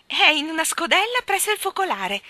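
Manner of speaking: high, loud eager answer; eager exclamation. Acted. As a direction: blurt it out proudly. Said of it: "esclamò il paggetto".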